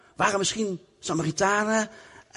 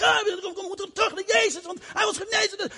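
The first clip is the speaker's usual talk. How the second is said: high, urgent pleading; excited leper. Acted. Leper insists to the others they return to Jesus, high and urgent. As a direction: pull your friend along.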